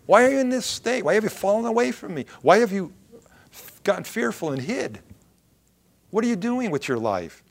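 high, insistent volley of questions; urgent confrontation. Acted. God challenges Elijah for falling away, insistent confrontation. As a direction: press each question home, pitched well up. God's questions pressed in preaching.

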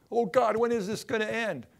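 an impatient prayer flung loudly at God; exasperated cry to God. Retold or imitated. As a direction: cry out, fed up with waiting.

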